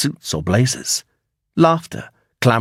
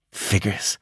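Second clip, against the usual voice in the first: unenthusiastic; 3 semitones lower, 7 dB quieter than usual. A narrator's reading. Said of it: "she agreed without much enthusiasm".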